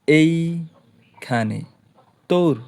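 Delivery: very slow, drawn-out এইখানে; এইখানে said in one slow, stretched breath; syllable-by-syllable. Shown as a demonstration. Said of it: "একবার উচ্চারণ করতে, করা লাগতাছে".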